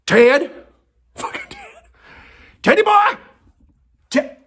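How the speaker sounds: high sing-song calls to the dog; calling Ted. Real, not acted. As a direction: call him in with a lilt.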